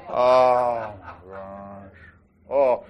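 ordinary talk

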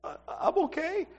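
high, soft, hesitant reply; shaken young girl. Retold or imitated. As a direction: imitate a girl barely holding together. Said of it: "I see her kinda shaking".

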